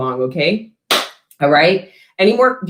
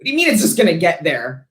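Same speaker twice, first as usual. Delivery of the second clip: mock indignant reply; 5 semitones higher than usual. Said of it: "but it's like".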